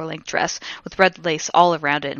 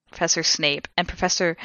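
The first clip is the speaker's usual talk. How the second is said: ordinary delivery, nothing marked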